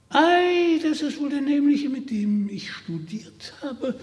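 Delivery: high, delighted cry; excited exclamation. Acted. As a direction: exclaim it in sudden glee. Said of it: "rief er aus". (freudiger Ausruf)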